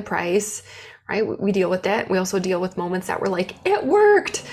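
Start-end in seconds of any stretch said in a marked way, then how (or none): 3.6-4.4 sudden much higher, louder burst of joy; excited quote. Retold or imitated. Entrepreneur imagining a launch that finally worked, excited high burst. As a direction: blurt it out like you can't believe it worked.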